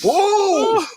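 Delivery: long, high-pitched "whoa"; high exclamation. Retold or imitated. Father in his outburst, high exclamation. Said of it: "He was".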